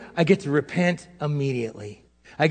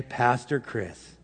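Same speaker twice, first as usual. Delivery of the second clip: ordinary delivery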